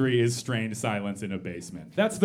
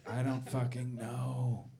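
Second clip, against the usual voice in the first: quiet resigned; 3 semitones lower, 8 dB quieter, slower than usual. Real, not acted.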